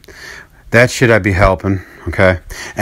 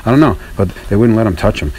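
ordinary delivery

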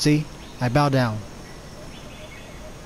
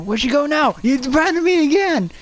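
indignant yell; 9 semitones higher than usual. Real, not acted. Gamer to teammate who left him, indignant yell. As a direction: call out the deserter, pitched up.

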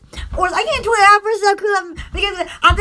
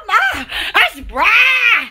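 normal talking voice

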